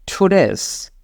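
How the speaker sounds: "Today's" opening with an aspirated th-like T. Shown as a demonstration. Teacher corrects learners' T, "Today's" with aspirated T. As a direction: puff of air on the T of "Today's". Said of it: "ঠ দিয়ে উচ্চারণ".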